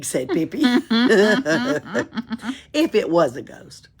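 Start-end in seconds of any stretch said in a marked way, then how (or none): 0.7-2.7 loud burst of laughter; real laugh. Real, not acted. Reader laughing at Pippi's owl joke, real laughter. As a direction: laugh right out.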